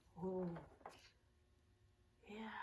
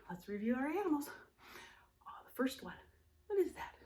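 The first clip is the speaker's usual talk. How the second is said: usual speaking voice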